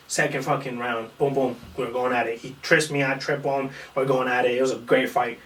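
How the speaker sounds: usual speaking voice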